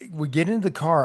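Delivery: ordinary delivery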